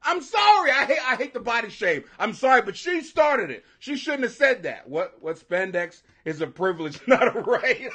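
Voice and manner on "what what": usual speaking voice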